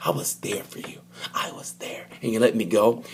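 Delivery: whispered heartbroken plea; mock whisper. Nicky to Ducati, whispering a wounded plea. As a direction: breathe it out like a jilted lover.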